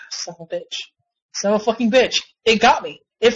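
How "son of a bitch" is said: a sigh, then the curse muttered much softer; sighed under breath. Real, not acted. Gamer muttering to himself after a bad loss, sighing and hushed. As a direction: let it out on a deflated breath, barely voiced.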